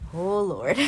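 a dramatic "oh Lord"; mock dread over family bipolar. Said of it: "and I'm like".

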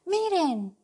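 high, loud whine; whimpering cry. Acted. The bird whining to the others about his lost size. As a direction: wail it like a sulking child. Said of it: "que lloriqueó".

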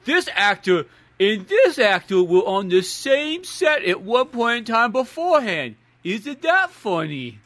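slow, silly put-on voice; mock trivia writer. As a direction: drag it out like a dim trivia fan. Mocking people who write such trivia.

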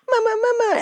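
very high, loud call for mama; imitating hurt toddler. Mom mimicking her sick son, mock toddler wail. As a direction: cry out like a little one wanting comfort. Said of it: "he's sick or he hurts himself, he goes".

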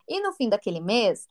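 normal talking voice

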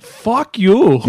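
loud, high New York accent, hammed up; bad Rosie Perez impression. Loud bad New York accent as Rosie Perez tells off the crowd. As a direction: fire it back in a bad Nuyorican accent. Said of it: "Worst accent ever".

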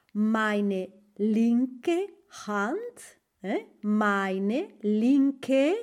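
very slow, carefully spaced German; teacher modelling a German sentence slowly; slow teaching read. Shown as a demonstration. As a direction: lay out every word for the learner. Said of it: "¿Cómo lo diría? Pues así".

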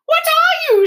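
very high, loud doll voice; high-pitched voice. Acted. The china doll asking Edward what he is, high-pitched. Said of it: "in a high-pitched voice".

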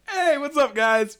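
loud, high call-out greeting; imitated hearty greeting. Voicing Fish's cheerful greeting.

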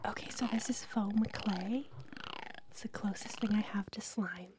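soft unvoiced ASMR whisper. Real, not acted. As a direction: whisper close to the mic for ASMR. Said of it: "should we do our favorite sounds?".

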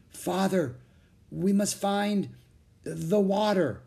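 high, halting, slow plea; weary knight urging his father; exhausted young knight. A narrator's reading. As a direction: struggle to get each phrase out. Exhausted young knight struggles to speak.

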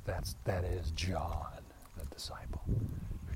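slow hushed whisper; slow, voiceless aside. Real, not acted. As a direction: lean in and whisper the name. Conspiratorial aside about John.

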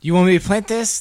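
a friendly spider asking; gardener spider voice. Acted. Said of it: "The gardener spider says".

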